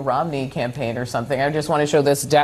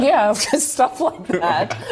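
laughing; 7 semitones higher, much slower than usual. Real, not acted. Laughing echo of guest's phrase.

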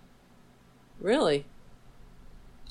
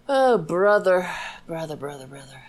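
exasperated fade; 4 dB louder than usual. Real, not acted. Exasperated sigh fading out.

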